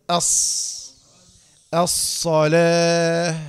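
"أص" and "الصلاة" with a long whistled ص; whistling sibilant. Shown as a demonstration. Teacher demonstrates safir, long whistling "الصلاة". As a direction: draw out "الصلاة" very slowly, hissing the ص. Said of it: "الصفير كده كل".

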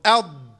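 "أض" with a heavy d pressed and held; loud isolated "أض", air shut in; dad articulation. Shown as a demonstration. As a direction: press the ض of "أض" from the tongue's side. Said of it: "قل كده".

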